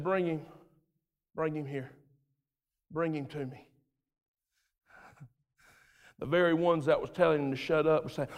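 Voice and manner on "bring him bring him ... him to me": soft, low, gentle bring him to me; Jesus gently calls for the beggar; tender call. Acted.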